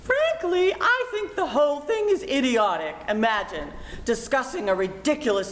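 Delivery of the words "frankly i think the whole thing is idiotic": indignant objection. Acted. Mother objects to teen marriage.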